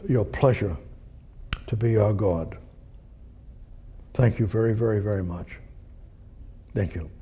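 soft, hushed prayer; quiet prayer. Acted. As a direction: pray low and intimately. Prays quietly.

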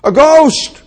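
loud, high acted cry of terror; dramatised frightened shout; shuddering cry. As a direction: cry out as if seeing a spirit. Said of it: "Shuddering cries".

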